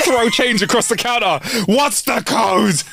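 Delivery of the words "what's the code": high, drawn-out comic plea; hammed-up urgent begging; mock desperate demand. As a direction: drag out the question in put-on desperation. Acted desperate demand for toilet code.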